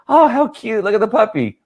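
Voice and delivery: mock squeal at a cute puppy; high-pitched imitation of cooing at a puppy; cooing over a puppy. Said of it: "our voice will change".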